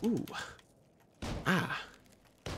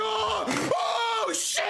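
real high-pitched startled yell; scared shout; 12 semitones higher than usual. Real, not acted. Streamer jumped by a scare in the game, scared shout. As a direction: cry out as if jumped. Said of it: "that scared me so bad".

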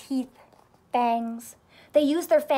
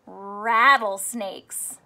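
slow title; 3 semitones lower, 4 dB louder, much slower than usual. Shown as a demonstration. Teacher reads chapter title dramatically.

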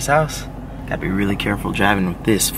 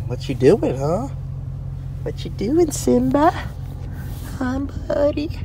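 cat voice; much slower than usual. Real, not acted. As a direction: coo up high at the kitten. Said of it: "Sorry for my cat voice".

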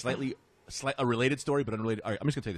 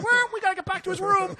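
innocent orderly; 3 dB louder than usual. Retold or imitated.

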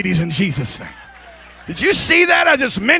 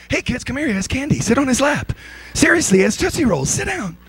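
mock grudging coaxing; 6 semitones higher than usual. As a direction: coax the kids back under orders, comically insincere. Disciples grudgingly call kids back.

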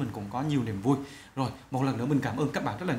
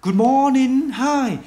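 bright, loud and high model greeting; upbeat greeting demo; 9 semitones higher, 8 dB louder, much slower than usual. Shown as a demonstration. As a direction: say it like greeting a friend cheerfully. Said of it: "Ví dụ chỗ này". (chào hỏi to và vui vẻ làm mẫu)

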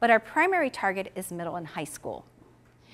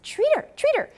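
put-on higher, quick urgent prompt to the boy; eager urging; 4 dB louder than usual. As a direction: hurry him to reward the bird. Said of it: "And Ron said".

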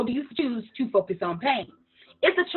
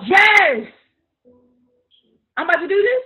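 excited yes; 6 semitones higher, 6 dB louder than usual. Retold or imitated. Excited yes to presidential news.

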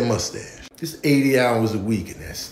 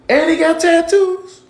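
mock shock; 7 dB louder than usual. Shocked by a twelve-year-old.

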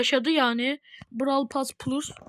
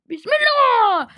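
pleading excitement; 8 semitones higher, 7 dB louder, slower than usual. Real, not acted. Praying for a legendary drop.

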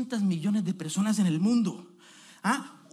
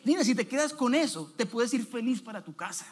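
ordinary talk, nothing marked

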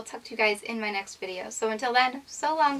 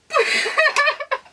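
delighted giggle; 5 dB louder than usual. Real, not acted. Delighted laugh.

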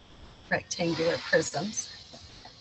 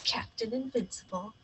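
dramatic whisper; 6 dB quieter than usual. Acted. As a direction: breathe the title like a secret.

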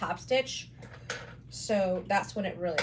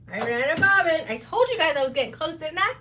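high, loud rueful exclaim; excited exclaim; 6 semitones higher, 7 dB louder than usual. Real, not acted. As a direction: call it out, amused. Bobbin ran out, exclaiming.

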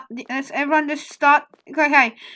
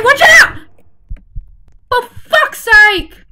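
urgent warning yell; shouting; 7 semitones higher, 9 dB louder, much slower than usual. Real, not acted. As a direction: shout the warning, then curse slowly. Warning teammate, then frustrated.

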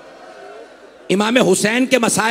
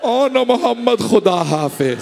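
sorrowful farewell, eyes closed. Acted. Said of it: "आंखें बंद करके कहा".

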